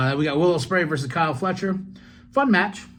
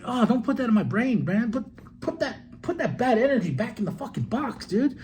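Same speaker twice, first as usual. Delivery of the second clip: high, pleading mock protest; exasperated plea; 8 semitones higher than usual. Answering his last-match talk, a high pleading protest. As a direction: beg him to take it back. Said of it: "I'm just like".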